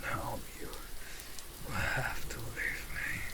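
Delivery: breathy, dragged-out words of need; strained hunger. Acted. Starving vampire telling companion to leave, breathy and strained. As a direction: struggle against the craving, slowly.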